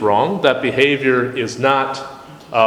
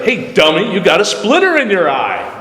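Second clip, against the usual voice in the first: a bit louder, bluntly insulting; rude and harsh; 5 semitones higher, 3 dB louder than usual. Pretend. Pointing out a friend's splinter, rude and harsh. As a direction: talk down to them like they're dumb. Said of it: "the tone of your voice".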